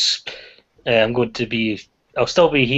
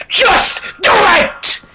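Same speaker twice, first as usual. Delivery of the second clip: much higher, slow, drawn-out yell; Shia LaBeouf impression; 6 dB louder, much slower than usual. Imitating Shia LaBeouf's video to friends, drawn-out yell. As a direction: stretch it out like a manic motivator. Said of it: "walking around saying".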